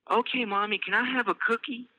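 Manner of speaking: put-on high, sweet child voice asking for a cookie; little girl Elise. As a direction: play a small girl begging sweetly.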